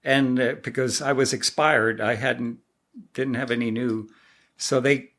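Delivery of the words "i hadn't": ordinary delivery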